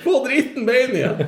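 high, loud, laughing mock outrage; mock exasperated driver. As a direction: Mika snapping back at her panicking co-driver. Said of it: "Hun bare". (høy, leende, hammed-up irritert sjåfør)

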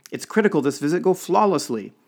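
high, loud, with a hysterical edge; hysterical protest. A narrator's reading. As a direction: protest it on the verge of panic. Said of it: "note of hysterical protest in his voice".

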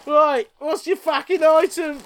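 high giggly question through laughter; laughing while talking. Real, not acted. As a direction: squeeze it out between laughs. Laughing after counting.

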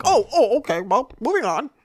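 high falsetto brush-off; hammed-up squeaky embarrassment; flustered mock voice. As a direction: imitate someone caught out and hurrying past it. Mocking someone corrected on air.

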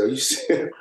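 asked through a laugh; can't believe it, laughing; mock-stunned question with a chuckle; laughing disbelief. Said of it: "I said".

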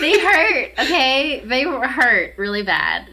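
loud, high, laughing insistence; laughing protest. Real, not acted. Storyteller insisting to co-host that pinches hurt, laughing protest.

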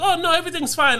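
high-pitched mock reassurance; teacher's reassurance, imitated. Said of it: "the teacher was like".

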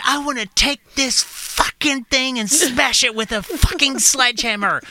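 raging at the radio that won't turn off; ranting. Retold or imitated. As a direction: rave it out like you could smash the thing. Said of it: "ranting and raving".

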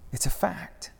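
high whisper. Acted. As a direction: let it slip out in a whisper. Jesus's hushed aside.

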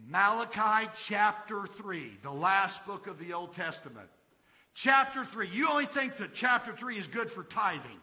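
big pulpit delivery; emphatic preaching. Acted. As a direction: hammer the chapter home to the congregation. Preaches at full force.

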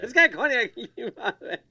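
delighted punchline laugh. Real, not acted. Telling the cognac joke to a friend, laughing.

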